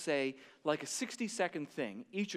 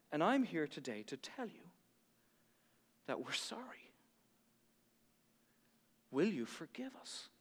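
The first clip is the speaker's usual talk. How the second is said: quiet heartfelt apology; 6 dB quieter than usual. Retold or imitated. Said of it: "And then he said this".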